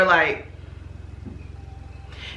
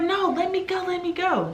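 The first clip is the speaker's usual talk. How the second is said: high-pitched, softer pleading whine; mock high protest; 11 semitones higher, 4 dB quieter than usual. Friend pulling away to join the fight, high whiny plea. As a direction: squeak out a struggling plea.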